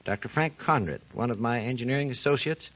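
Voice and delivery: ordinary talk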